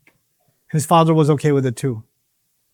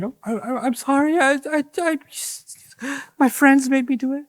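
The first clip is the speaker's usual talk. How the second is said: higher, softer, faltering mock apology; mock stammering excuse; 8 semitones higher, 3 dB quieter than usual. Imitating a scared boy making excuses to the crowd, faltering. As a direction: stammer like a caught kid.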